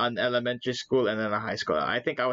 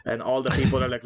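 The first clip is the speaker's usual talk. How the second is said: ordinary talk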